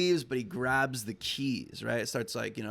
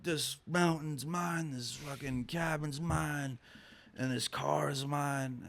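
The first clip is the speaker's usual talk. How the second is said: imitating ranting crackhead; 3 semitones higher, 5 dB quieter, slower than usual. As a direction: stretch each phrase like a deluded squatter. Crackhead claims everything as his.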